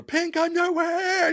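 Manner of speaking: high, drawn-out mock toddler yell; high-pitched kid yelling, imitated. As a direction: stretch it out like a yelling two-year-old. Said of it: "She's yelling".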